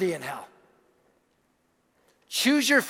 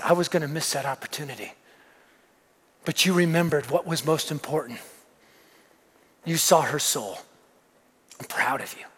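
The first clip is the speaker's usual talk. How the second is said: low, softened, heartfelt father's voice; tender praise; 9 semitones lower, 5 dB quieter than usual. Retold or imitated. Convicted dad telling his son he's proud, low tender confession. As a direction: let it sink to a near-whisper by the end.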